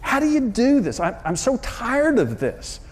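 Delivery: weary despair. Retold or imitated. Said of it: "sitting there just thinking".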